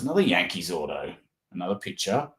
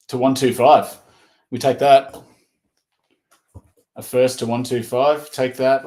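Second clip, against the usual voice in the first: higher and louder, pumped about a numbered card; excited; 4 semitones higher, 7 dB louder than usual. Real, not acted. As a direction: celebrate the pull, punchy.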